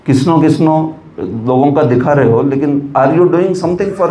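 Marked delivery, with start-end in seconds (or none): 0.1-0.9 कृष्णो as "kisno", r dropped; mispronounced Krishna. Retold or imitated. Mocking the critic's complaint, parroting "kisno". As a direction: repeat कृष्णो as "kisno", ending on o. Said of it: "they pronounce like Krishna".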